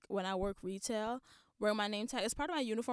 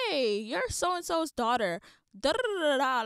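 mock stranger; 5 semitones higher, 6 dB louder than usual. Imitating a stranger at a shower.

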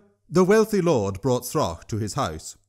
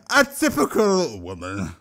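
troll voice =36. A narrator's reading. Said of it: "Throck said".

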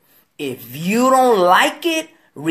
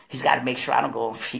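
usual speaking voice

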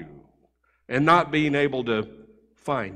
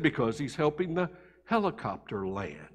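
put-on small, hushed child's hello; a timid kid picking up the phone, hushed; quiet little boy; 2 semitones lower, 9 dB quieter than usual. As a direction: answer softly like a shy little boy. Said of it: "real quiet and soft".

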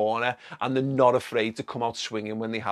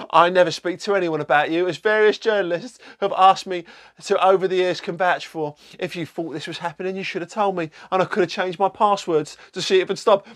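mock Rebecca Vardy voice; 6 semitones higher, 4 dB louder than usual. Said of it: "I don't know if that's a voice".

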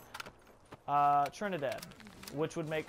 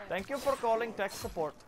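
higher Indian tech-support voice; Indian-accented call-centre greeting; mock Indian accent; 6 semitones higher than usual. Shown as a demonstration. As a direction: put on an Indian accent, answering the phone. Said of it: "That's regular Indian".

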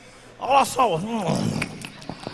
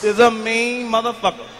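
ordinary talk

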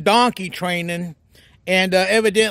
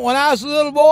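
normal talking voice

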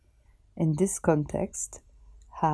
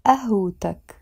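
ordinary talk, nothing marked

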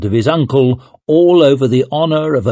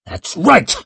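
defiant retort; 8 semitones higher than usual. Acted. Leo retorts while sparring.

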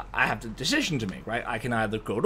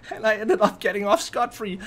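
higher, laughing through the words; 8 semitones higher than usual. Real, not acted. Telling viewers he got off free, laughing. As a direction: say it while cracking up.

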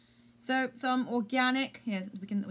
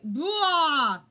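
loud gagging blah; 7 semitones higher, 10 dB louder than usual. Real, not acted. Gagging at the smell.

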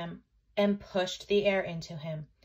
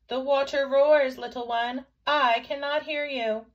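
loud, raised motherly reply; mother calling back; 4 semitones higher, 6 dB louder than usual. A narrator's reading. Mother unable to hear her little one, calling loudly. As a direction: call back over the noise. Mother calls over roaring water.

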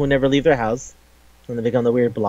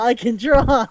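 mock high voice; 10 semitones higher, slower than usual. Repeated in high put-on voice.

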